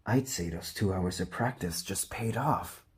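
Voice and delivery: ordinary delivery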